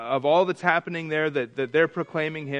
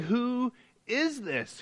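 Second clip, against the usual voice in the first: high, slowly stretched mock amazement; stirred-up wondering; 7 semitones higher, 4 dB quieter, much slower than usual. Teacher voicing the stirred city of Jerusalem, wondering aloud. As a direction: draw the question out high, imitating an astonished crowd. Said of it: "all the city was stirred".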